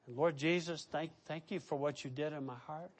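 soft, halting prayer of a new believer; shy heartfelt prayer; humble simple prayer. Shown as a demonstration. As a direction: pray quietly and plainly, unsure of the words. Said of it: "Humbly talk to God".

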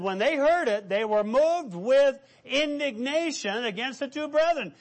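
preaching the verse with rising force; forceful scripture reading. Acted.

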